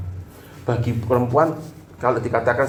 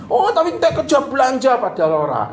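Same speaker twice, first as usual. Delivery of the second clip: very high, louder mocking husband; hammed-up quarrel voice; mock retort; 14 semitones higher, 4 dB louder than usual. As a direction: scoff at her claim, pitched high. Acted quarrel continues.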